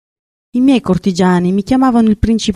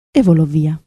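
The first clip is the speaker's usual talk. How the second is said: usual speaking voice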